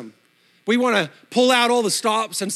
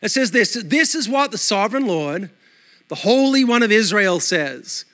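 normal talking voice

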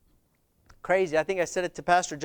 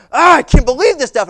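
much louder, higher indignant blurt; angry outburst; 6 semitones higher, 12 dB louder than usual. Retold or imitated. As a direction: vent your outrage at the screen. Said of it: "'cause I was just getting mad".

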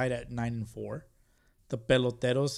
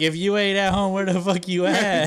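higher, louder nagging wife; angry wife; 6 semitones higher, 5 dB louder than usual. Retold or imitated. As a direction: snap at him like a fed-up wife. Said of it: "she's gonna be like".

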